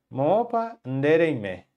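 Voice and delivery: "Moopa ndereime" said slowly, syllable by syllable; slow model question. Shown as a demonstration. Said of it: "A pergunta". (pergunta em guarani dita bem devagar)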